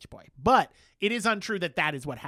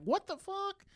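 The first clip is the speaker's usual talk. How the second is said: mock shocked; 14 semitones higher, 6 dB quieter than usual. James reacting in recap.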